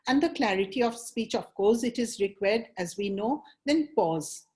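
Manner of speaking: normal talking voice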